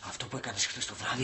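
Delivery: low, hushed reproach; speaking quietly. Acted. Man confronting housemate while someone sleeps, hushed. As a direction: keep it down so the sleeper stays asleep. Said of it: "Γιατί μιλάς σιγά".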